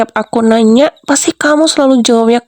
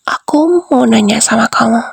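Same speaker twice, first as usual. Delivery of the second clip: ordinary talk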